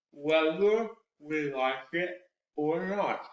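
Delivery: slow, each syllable drawn out around the fingers; with fingers in mouth, very slow. Shown as a demonstration. Cork work tongue twister with fingers in the mouth. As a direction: articulate every syllable slowly. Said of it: "go extremely slowly and articulate every single syllable".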